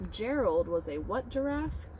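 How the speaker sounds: ordinary delivery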